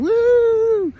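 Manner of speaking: very high, loud joyful whoop; gleeful whoop. Retold or imitated. Kevin shooting out the door on the toboggan, wild whoop. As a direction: whoop like a kid flying on a sled.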